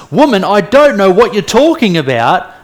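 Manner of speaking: loud, high, acted outburst of denial; indignant. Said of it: "is so indignant that he says".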